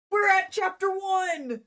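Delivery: loud, high, drawn-out cheer; triumphant shout. Real, not acted. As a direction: shout it out like a victory. Celebrates reaching chapter one.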